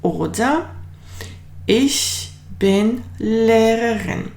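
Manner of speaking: slow clear German sentence for learners; slow model sentence. Shown as a demonstration. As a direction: model it slowly for the class. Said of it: "Once again".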